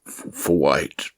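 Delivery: slow, halting stammer on the first word; a shaken man echoing the question; hesitant stumbling echo; stammering repeat. Acted. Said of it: "he repeated".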